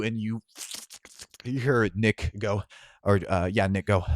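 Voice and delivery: normal talking voice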